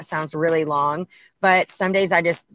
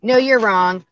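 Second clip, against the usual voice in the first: firm retort; 5 semitones higher, 7 dB louder than usual. Retold or imitated. Retold retort to ignorant person.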